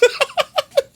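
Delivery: high squeaky giggle; squeaky laugh after the confession. Real, not acted.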